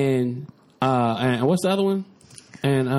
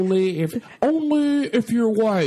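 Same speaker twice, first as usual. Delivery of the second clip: slow, drawn-out mock Trump line; lightly voiced Trump, stretched out slow; slow mock Trump quote; 6 semitones higher, slower than usual. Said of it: "He gonna be like".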